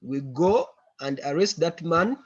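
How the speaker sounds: ordinary talk, nothing marked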